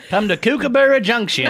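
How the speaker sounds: mock Australian ad. Mock Aussie tourism ad.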